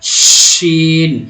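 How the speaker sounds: شین held long, loud rushing sh with teeth apart; airy drawn-out sheen. Shown as a demonstration. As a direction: let air stream out on the sh of شین. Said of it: "یہ دانت تھوڑے کھلے رہیں گے".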